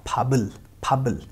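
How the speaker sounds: फाबल slowly, joined with a voiced b; correct carry-over pronunciation. Shown as a demonstration. Teacher repeating the word for learners, correct carry-over pronunciation. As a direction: say फाबल as pha-bul, no break after p. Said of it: "इसका pronunciation हो जाएगा".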